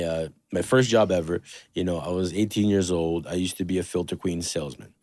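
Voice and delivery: ordinary talk, nothing marked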